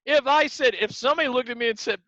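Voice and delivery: ordinary talk, nothing marked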